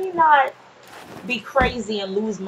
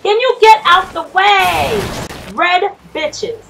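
loud demand; 7 semitones higher, 7 dB louder, slower than usual. Real, not acted. Yelling at zombies blocking path.

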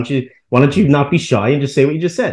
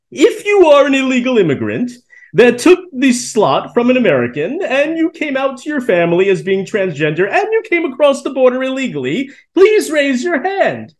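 exaggerated mock announcer; 8 semitones higher than usual. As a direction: push the robot parody over the top. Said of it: "it's like".

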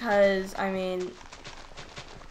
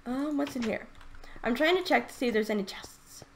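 ordinary delivery

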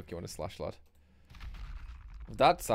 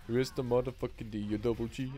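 a light rap swagger; hint of a hip-hop boast; mock rapper. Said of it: "I don't know why I went into that".

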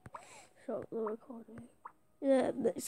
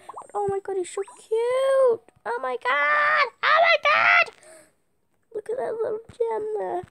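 high squeal of delight; 10 semitones higher, 4 dB louder than usual. Real, not acted. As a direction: squeal over a cute puppy. Squealing over cute puppy.

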